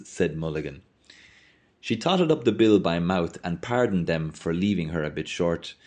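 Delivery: plain reading voice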